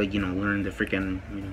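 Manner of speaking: ordinary talk, nothing marked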